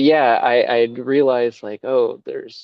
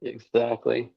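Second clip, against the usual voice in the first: normal talking voice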